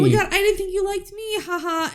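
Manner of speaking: high, giddy mock delight with a tiny laugh; giddy imitation. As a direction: play up a smitten kid's thrill. Mimicking Scott's giddy reply.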